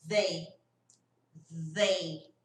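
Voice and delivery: "they" with a buzzing TH, tongue between the teeth; voiced TH demo. Shown as a demonstration. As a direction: vibrate the TH of "they". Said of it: "E aqui". ("they" com TH vibrando)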